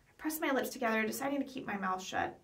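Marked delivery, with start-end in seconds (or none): none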